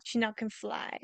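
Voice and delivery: ordinary talk, nothing marked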